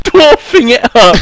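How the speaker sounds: laughing shout. Real, not acted. Echoing the dwarf line.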